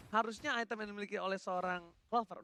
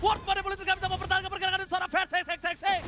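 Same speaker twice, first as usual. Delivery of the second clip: loud, high hyped kill calls; fighting game announcer; 10 semitones higher, 9 dB louder than usual. Acted. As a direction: call the kills like an arena announcer. S0 hypes the kills live.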